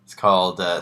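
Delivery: ordinary delivery, nothing marked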